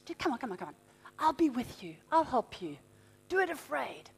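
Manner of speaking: soft, low coaxing; the Holy Spirit quietly coaxing a hesitant believer; gentle urging voice. Retold or imitated. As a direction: nudge them on, hushed and low. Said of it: "And he's saying".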